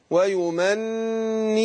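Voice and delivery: ويمنيهم with the doubled nun held as a two-beat nasal hum; nasal ghunna. Shown as a demonstration. Teacher to student showing ghunna on ويمنيهم. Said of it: "النون مشددة، هتاخد غنة حركتين".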